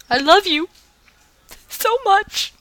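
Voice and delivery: high, loud gushing. Real, not acted. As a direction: swoon over the writer. Gushing over a fan's story.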